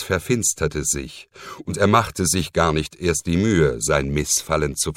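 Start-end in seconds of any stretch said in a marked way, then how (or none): none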